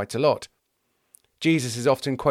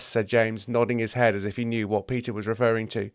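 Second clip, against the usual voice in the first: ordinary delivery, nothing marked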